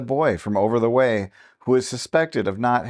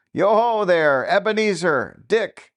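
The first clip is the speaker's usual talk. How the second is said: high, loud jolly call across the room; jovial fat voice; 8 semitones higher, 3 dB louder than usual. A narrator's reading. Old master calls his apprentices, jovial loud hail. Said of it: "called out in a comfortable, oily, rich, jovial, fat voice".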